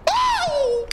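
very high, loud startled shriek; shriek of fright. Real, not acted. Player shrieks as the creature appears, startled. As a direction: jolt out a shriek. Jumpscare in horror game.

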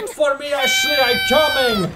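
very high, loud mock shout; shouting in character. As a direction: call after her in a high role voice.

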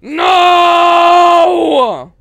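high, loud terrified scream. Real, not acted. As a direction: scream in fright. Jump scare scream.

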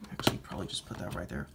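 whispered aside. Real, not acted. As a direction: whisper the correction to yourself. Mutters correction to himself.

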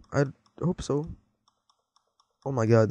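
ordinary talk, nothing marked

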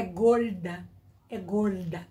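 imitated Paulista R in "gorda"; São Paulo retroflex R, pulled in "gorda"; Paulista accent. Shown as a demonstration. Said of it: "já puxa no R".

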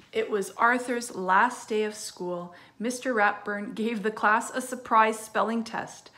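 lively storybook read-aloud; reading to children. Acted. Teacher reading a story to her class, lively storytelling. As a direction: read it brightly to young kids. Reads a picture book aloud.

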